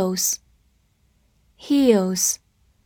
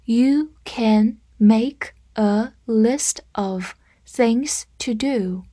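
very slow, one word at a time; slow read-through; much slower than usual. Shown as a demonstration. Teacher demonstrating the list sentence for learners, slow read-through. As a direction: read it out slowly, separating each word. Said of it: "我们来慢读一遍".

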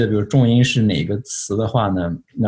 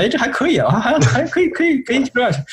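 much higher, pleasantly surprised inner voice; relieved surprise. Retold or imitated. Speaker recalling his first talk's inner voice, high relieved surprise. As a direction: voice the relief of finding it's going fine. Inner voice after nervous start.

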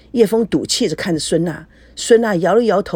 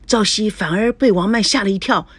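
ordinary delivery, nothing marked

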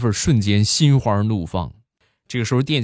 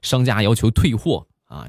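usual speaking voice

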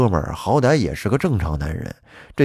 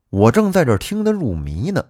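ordinary delivery

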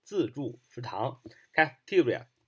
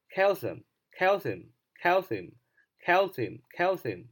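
slow, higher repeated calcium; slow drill repetition; 5 semitones higher, much slower than usual. Shown as a demonstration. Teacher drills calcium slowly and higher for students. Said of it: "名词钙".

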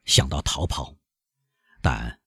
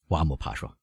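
ordinary delivery, nothing marked

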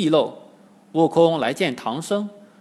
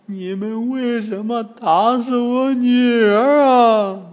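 high, slow, drawn-out lament; wailing old woman; 7 semitones higher, 5 dB louder, slower than usual. A narrator's reading. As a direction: wail accusingly as a bereaved old woman. Old woman wails over slain daughter. (老婆婆拖长声音高声哭诉)

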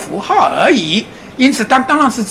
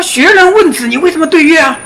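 higher, louder indignant question; louder, higher student challenging his teacher; protesting monk; 7 semitones higher, 4 dB louder than usual. Retold or imitated. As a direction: push back at the master, puzzled. Young monk protesting to master.

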